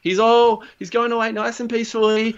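high mock-cheerful showman voice; reassuring inventor. Imitating the inventor reassuring the crowd, high mock cheer. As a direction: sell it brightly despite the screams. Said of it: "And he goes".

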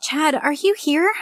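high, slow call to another room. A narrator's reading. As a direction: call out from the bedroom, unsure. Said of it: "I called from the bedroom".

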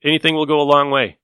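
ordinary talk, nothing marked

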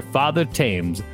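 normal talking voice

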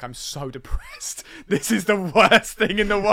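mock mopey whine. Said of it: "every week being like".

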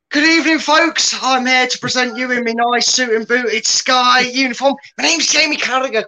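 high, loud Scouse presenter voice; hammed-up Scouse pundit with a Liverpool twang; Scouse accent. Pundit introducing his tactics board to viewers, in a high, loud Scouse accent. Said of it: "I'm gonna drop the Scouse accent".

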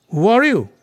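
loud, high, challenging question; demanding prince. A narrator's reading. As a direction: demand it forcefully of a stranger. Bhima demands the monkey's identity.

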